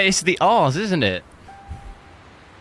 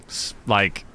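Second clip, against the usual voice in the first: ordinary talk, nothing marked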